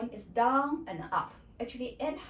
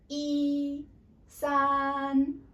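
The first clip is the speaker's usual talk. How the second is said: "E" and "san" each held high and level; flat first tone; 5 semitones higher, 5 dB louder than usual. Shown as a demonstration. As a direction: sustain "E" and "san" on one steady high pitch. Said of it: "It's high pitch and consistent".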